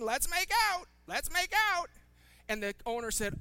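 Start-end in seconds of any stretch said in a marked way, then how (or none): 0.0-1.9 high, loud parrot imitation; female parrot. Owner imitating his parrot to the shopkeeper, loud high parrot voice. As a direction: squawk the stock phrase like a pet parrot. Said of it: "all continually saying".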